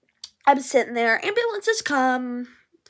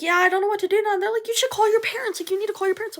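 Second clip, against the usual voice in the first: higher, lightly voiced chat; high mock voices; 9 semitones higher, much faster than usual. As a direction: say it all up high. High voiced bystander exchange.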